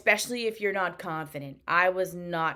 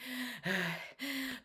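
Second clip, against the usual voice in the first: heavy sigh; 5 semitones higher, 11 dB quieter than usual. Retold or imitated. Anxious before opening result email.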